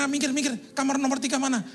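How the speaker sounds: high, loud and hurried, pushing people aside; patient hurrying the nurses aside to find his room; urgent rush. Retold or imitated. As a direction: brush past everyone, demanding the room. Rushing through hospital corridor.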